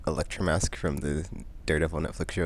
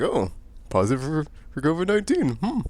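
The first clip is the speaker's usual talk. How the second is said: mock machine result; 9 semitones higher than usual. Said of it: "and it's like".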